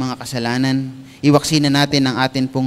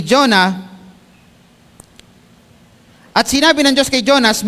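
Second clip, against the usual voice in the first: raised, ringing Tagalog scripture read; preaching at full force; 7 semitones higher, 5 dB louder than usual. Acted. Pastor reading Jonah's angry answer in Tagalog, loud preaching. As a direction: read the verse out like a sermon climax.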